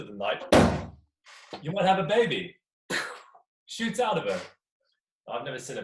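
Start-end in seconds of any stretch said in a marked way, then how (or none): 1.6-4.4 louder and higher, a comic jolt; bursting out with the surprise birth; sudden burst. A narrator's reading. Storyteller jolting the audience with a sudden birth.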